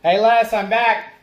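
loud, high call into the house. Real, not acted. Husband calling to wife from the door, loud and high. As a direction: announce yourself loudly coming home.

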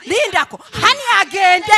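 high, loud angry demand, dragging out agende; furious shout. Acted. Wife shouting at husband to send his sister away, furious. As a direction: yell the demand, slowing on the last word.

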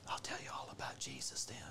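whispered secretive aside. Pretend. Mock missionary whispering the Jesus part to a stranger. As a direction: hush it like a secret.

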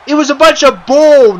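fuming wrestler telling the interviewer off; angry wrestler. Acted. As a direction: spit out "bunch of bull" in disgust. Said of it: "madder than ever".

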